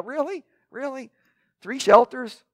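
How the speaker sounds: an exasperated, high-pitched "are you serious?"; mock disbelief. Mock disbelief at Peter's suggestion.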